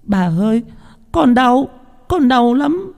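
put-on very high little-boy wail; crying, pleading child. A narrator's reading. Small boy begging the old woman to stop, tearful pleading. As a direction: beg in a high, drawn-out child's voice. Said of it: "thằng bé khóc lóc van xin".

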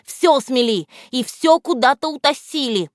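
can't stop complaining; agitated hare. Acted. The hare keeps complaining to Грамотейка, agitated. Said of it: "не унимался заяц".

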